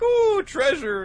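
very high, loud, slow squeal; squealing delight. Acted. Mages discovering the hoard, squealing. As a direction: gasp at the gold like a kid.

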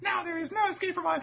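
very high put-on villain threat; hammed-up high-pitched menace; squeaky villain. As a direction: threaten in a silly falsetto. Voicing villain Pika after his laugh.

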